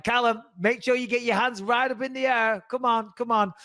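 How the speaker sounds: high-pitched, over-the-top coaching of a son; teasing mock urging, pitched well up; mocking fun. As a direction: play up the instruction to poke fun at the guards. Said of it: "having a bit of fun with it".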